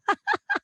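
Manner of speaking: squealing laugh; high burst of laughter. Real, not acted. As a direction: laugh high and hard at yourself. Laughing at own embarrassing story.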